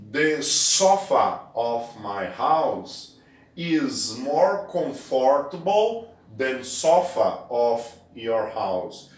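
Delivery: very slow, deliberate English sentence; slow model sentence. Shown as a demonstration. Teacher reading the sofa example slowly aloud. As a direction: dictate it word by word. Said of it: "Então, nós temos aqui".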